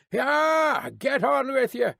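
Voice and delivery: high coachman's cry to his team; urging horses on. A narrator's reading. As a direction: drive the horses on with high calls.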